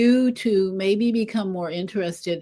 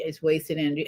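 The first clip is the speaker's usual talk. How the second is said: soft repeat; 4 semitones lower, 6 dB quieter, faster than usual. Real, not acted. Repeated verdict, softer.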